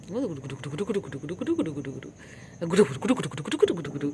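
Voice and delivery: soft rapid gudu-gudu pet chant; soft babble to a kitten; nonsense cooing. Real, not acted. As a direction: babble at the cat softly.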